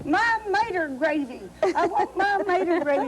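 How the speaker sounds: put-on high, whining child's tantrum; bouncing tantrum. Toddler Anita demands gravy from the maitre d', high tantrum whine. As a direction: wail it like a spoiled toddler. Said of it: "She was bouncing up and down".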